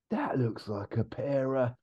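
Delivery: lightly voiced don musing to his students; mild, slow lecturer voice; Stephen Fry impression. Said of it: "I haven't done a very good impression of him".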